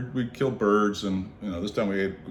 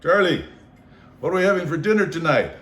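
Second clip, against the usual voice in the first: higher, louder call, quick on the question; 7 semitones higher, 6 dB louder than usual. Retold or imitated. Teasing the porter about dinner, raised call. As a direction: call across camp, brisk and raised. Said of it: "one day we had".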